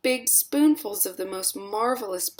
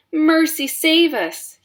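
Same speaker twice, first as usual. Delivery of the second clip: loud, high, slow yell; 10 semitones higher, 7 dB louder, slower than usual. A narrator's reading. Mother yells in horror, loud and high. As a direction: cry out to heaven. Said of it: "yelled Missus Beauregard".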